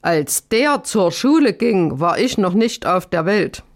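ordinary delivery, nothing marked

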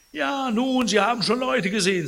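high, brisk, lightly scolding voice; exasperated housekeeper. A narrator's reading. Housekeeper tells the patient he was feverish, high mild scolding.

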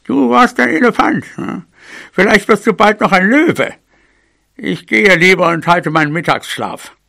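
high, sarcastic granny voice; put-on old mouse, dismissive; mocking mouse granny. A narrator's reading. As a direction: scoff at the youngster's tall tale. Grandmother mocks him, leaves for nap.

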